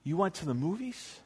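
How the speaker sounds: a hushed, shocked gasp of disbelief; scandalized Dutch woman gasping at the missionary. Retold or imitated. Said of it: "the Dutch woman just started going".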